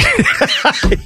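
high squealing laugh. Real, not acted. Laughs at queen joke.

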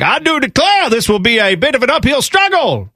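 mock Southern cartoon accent; Foghorn Leghorn Southern accent. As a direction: ham up a Southern rooster accent. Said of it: "A foghorn leghorn?".

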